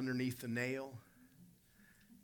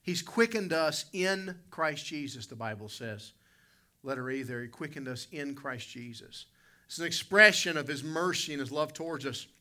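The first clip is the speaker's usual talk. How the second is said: normal talking voice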